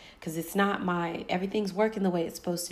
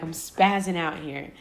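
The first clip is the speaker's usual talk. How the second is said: ordinary delivery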